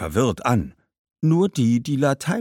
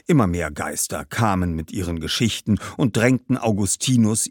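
usual speaking voice

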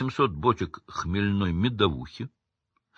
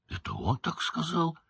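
high, hushed, amazed question; astonished; 8 semitones higher, 6 dB quieter than usual. A narrator's reading. Hagrid hearing what the teacher said, astonished. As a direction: ask softly in rising disbelief. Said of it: "изумился Хагрид".

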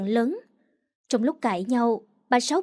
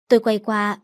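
ordinary delivery, nothing marked

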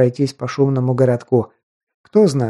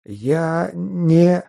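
frightened babble; 4 semitones higher than usual. Acted. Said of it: "пытался что-то пролепетать тот".

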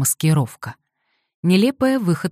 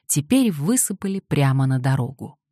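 ordinary delivery, nothing marked

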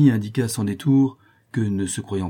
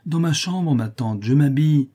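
high-pitched answer from another room; acted reply across the house; calling back; 6 semitones higher, 4 dB louder than usual. A narrator's reading. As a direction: call back lightly, pitched up, louder at the end. Niece calls back from room.

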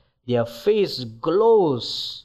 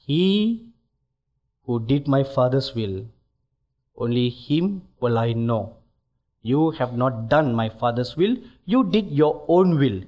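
calm judgement; 6 semitones lower than usual. Retold or imitated. Jesus answering the protesting preachers, low and calm. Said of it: "the Lord Jesus very calmly said".

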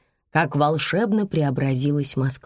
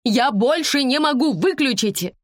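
high, exasperated outcry; high-pitched woman's desperate plea; crying out; 9 semitones higher than usual. A narrator's reading. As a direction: cry out at the end of your tether. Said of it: "закричала женщина".

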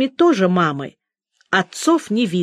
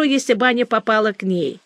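ordinary delivery

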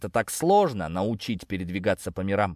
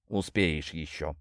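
lower, softer, slow snake; slow low serpent voice; 6 semitones lower, 5 dB quieter, slower than usual. A narrator's reading. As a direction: drop it slowly and dryly. Serpent dry reply.

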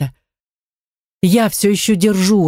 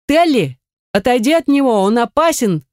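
high, urgent warning shout; 5 semitones higher than usual. Acted. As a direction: yell a warning to get away. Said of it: "кричат мне ребята".

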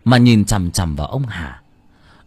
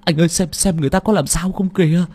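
much higher, louder frantic wailing; panicked tearful pleading; 10 semitones higher, 5 dB louder than usual. A narrator's reading. Assistant begging his boss to check the body, frantic tearful wail. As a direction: beg him to get out, near hysteria. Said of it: "Thằng Tiên vừa nói, vừa mếu máo".

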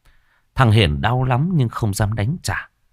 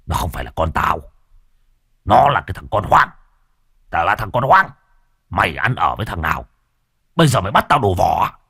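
harsh outburst, growing louder; angry denunciation; 3 dB louder, faster than usual. Acted. Husband disowns boy and accuses wife, angry denunciation. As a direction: spit out the accusation in fury.

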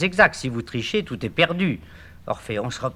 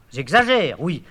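ordinary talk

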